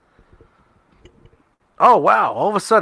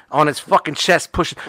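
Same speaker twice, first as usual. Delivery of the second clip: ordinary talk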